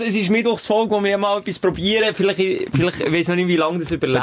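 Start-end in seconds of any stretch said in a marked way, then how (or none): 0.9-2.1 "probieren" leaned on, in a higher pitch; stress on PROBIEREN. Real, not acted. Pitching a tentative new Wednesday episode, stress on "probieren". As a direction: put the weight on "probieren". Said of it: "Betonung auf probieren".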